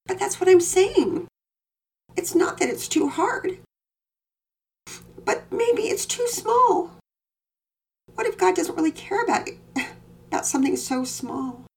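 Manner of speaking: put-on a small girl insisting on her worry; worried little girl. A narrator's reading. Daughter pressing her father about God caring. Penelope voiced high.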